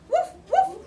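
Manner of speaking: loud, high playful barks; dog bark. Acted. Reading to child, the daunted dog barks loudly. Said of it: "who barked".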